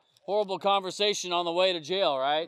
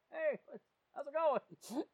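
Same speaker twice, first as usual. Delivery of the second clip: high, hushed call; mock distant greeting; 7 semitones higher, 9 dB quieter, slower than usual. Officer giving the time of day to a passer-by, high hushed greeting. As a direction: call hello in a small high voice.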